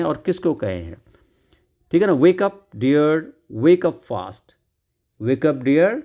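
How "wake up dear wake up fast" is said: louder, drawn-out reading; very slow read-aloud. Shown as a demonstration. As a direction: stretch every word for the class. Reading the story lines aloud.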